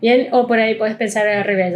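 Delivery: ordinary delivery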